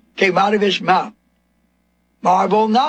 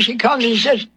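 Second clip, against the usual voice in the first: usual speaking voice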